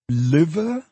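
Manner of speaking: high, rising self-question; musing to himself. A narrator's reading. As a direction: try the guess out on yourself. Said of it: "communing with himself".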